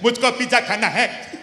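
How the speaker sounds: son's goofy mock voice, fast with a laugh; hammed-up kid whining for pizza; silly kid voice. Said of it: "Why do you sound so, like, you're challenged?".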